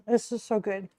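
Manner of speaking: normal talking voice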